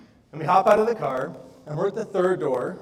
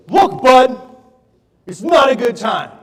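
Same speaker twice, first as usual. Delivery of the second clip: loud, higher, annoyed snap; upset customer; 7 semitones higher, 13 dB louder, slower than usual. Retold or imitated. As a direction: cut him off sharply and loudly. Upset customer snaps at salesman.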